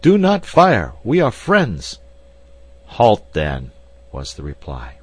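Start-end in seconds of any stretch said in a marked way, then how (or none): 0.0-2.0 loud, high warning shout; shouting loudly. Acted. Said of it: "cried loudly". 2.9-3.7 stern command. Acted. Man with gun answers.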